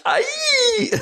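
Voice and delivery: very high squeal; high-pitched mock cry. Co-host imitating Seth's high-pitched cry. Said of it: "That's just that high pitch".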